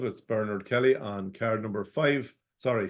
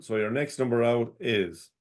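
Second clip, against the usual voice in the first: ordinary delivery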